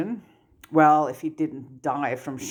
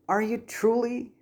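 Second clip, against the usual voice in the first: higher, disbelieving question; doubtful; 8 semitones higher than usual. Acted. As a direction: ask it as if you don't believe a word. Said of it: "in a tone of voice that suggested he didn't believe".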